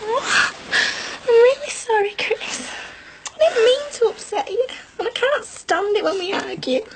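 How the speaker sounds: high, crying apology; sorry through tears, half laughing; tearful apology. Acted. Bride begs her groom's forgiveness after a row, crying high and breaking.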